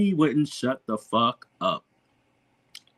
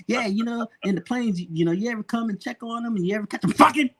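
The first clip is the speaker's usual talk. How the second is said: a touch higher, quick nosy prying; imitating the host; 9 semitones higher, faster than usual. Host quizzing him on air, mock nosy. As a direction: mimic the host's eager question. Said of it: "yelled it in his accent".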